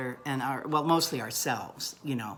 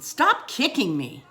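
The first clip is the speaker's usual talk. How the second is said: a sharp, much higher and louder blurt; loud retold blurt; 9 semitones higher, 7 dB louder, slower than usual. Wife at dinner loudly telling her husband to stop. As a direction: say it out loud so the whole table hears.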